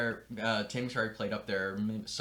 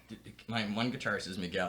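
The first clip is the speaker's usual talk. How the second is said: ordinary delivery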